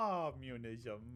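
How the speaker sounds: "communism" with a long cawed first syllable; crow-caw pun. Acted. A crow joking about communism, cawing the word. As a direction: draw out the start of "communism" like a crow's caw. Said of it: "I'm a crow, so it's".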